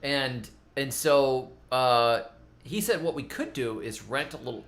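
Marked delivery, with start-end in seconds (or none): none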